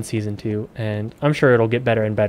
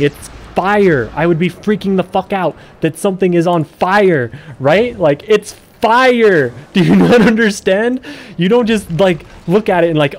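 laughing, louder and higher in disbelief at a dumb show; incredulous rant; 6 semitones higher, 4 dB louder than usual. Real, not acted. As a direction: pitch climbing high and loud with laughing disbelief. Ranting about nobody reacting to fire.

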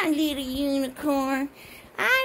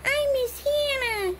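high mock-sad toy voice; crying monkey; 10 semitones higher than usual. Little monkey missing Hannah, high pretend crying. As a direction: whine it like a tiny crying toy. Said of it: "Don't cry".

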